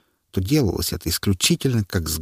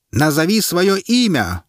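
slow, high stern demand; 9 semitones higher, 4 dB louder, slower than usual. A narrator's reading. Herald demanding the prisoner's name, stern. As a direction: order him to give his name. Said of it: "потребовал глашатай".

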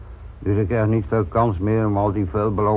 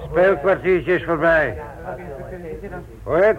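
calling class to order; 6 semitones higher than usual. Acted. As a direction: silence the room like a strict teacher. Teacher calls unruly class to order.

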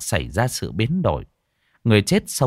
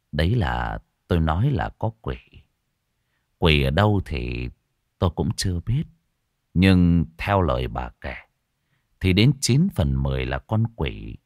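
low, solemn monk's voice; grave old monk; 3 semitones lower than usual. Acted. Monk telling the wife a demon is inside, low and grave. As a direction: speak low and heavy, like a monk warning of evil.

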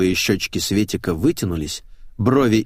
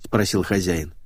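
ordinary delivery

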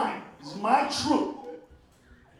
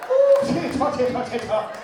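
excited shriek; 4 semitones higher, 10 dB louder than usual. Retold or imitated. Woman reacting to dragon.

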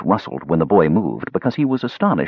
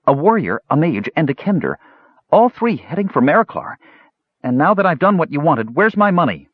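put-on high-pitched child making a demand; boy reporting, then boldly claiming pay; pitched-up, pushy kid; 7 semitones higher than usual. A narrator's reading. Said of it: "the child demanded boldly".

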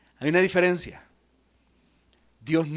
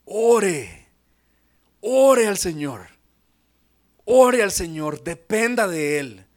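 louder, pressing call to pray; forceful plea; 8 dB louder, slower than usual. Acted. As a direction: urge them to pray, hard. Preacher pleads to pray. (llamado enérgico a orar)

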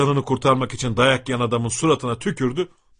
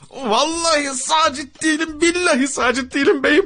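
very high-pitched desperate wail; begging; 3 dB louder than usual. A narrator's reading. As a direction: plead tearfully, swearing innocence over and over. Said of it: "Adam yalvarıyor". (yalvaran, feryat figan)

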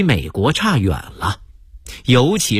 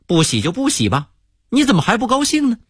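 puzzled asking; 8 semitones higher, faster than usual. Retold or imitated. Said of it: "美国人很不理解".

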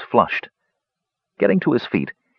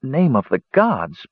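halting, high starts with long gaps; shaken, broken phrases; faltering; 7 semitones higher, slower than usual. A narrator's reading. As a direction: stammer to a stop, then go on slowly. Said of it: "Palin faltered".

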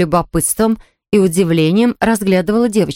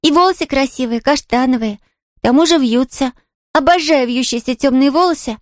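put-on high, delighted child's voice; admiring little girl; 7 semitones higher than usual. Girl praising the invalid's looks, admiring. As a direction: gush compliments as a little girl.

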